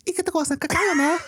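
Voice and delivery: high, fast girly mock-Japanese; squeaky anime girl voice; Japanese girl impression. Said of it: "I love your Japanese girl impression".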